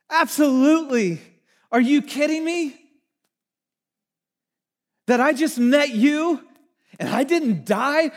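like Donkey bouncing to go along; eager jumping up. Retold or imitated. Isaiah eagerly volunteering, like Donkey jumping up, high and loud. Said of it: "this jumping up".

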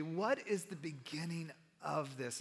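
normal talking voice

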